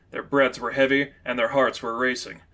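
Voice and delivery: ordinary delivery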